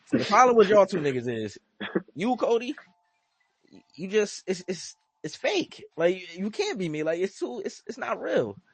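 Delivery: high-pitched imitation of a wrestler's callout; mock berating, halting and high; retold berating promo. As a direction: retell his put-down, pitched well up.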